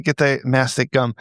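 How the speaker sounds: normal talking voice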